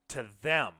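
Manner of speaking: ordinary delivery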